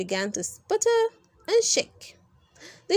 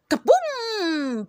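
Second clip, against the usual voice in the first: kaboom sound; 7 semitones higher than usual. Acted. Imitated explosion.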